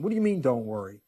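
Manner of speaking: a worried churchgoer pushing back, climbing to an exasperated peak; worried objection. Pretend. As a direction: voice the listener's rising exasperation. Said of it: "you may be sitting there going".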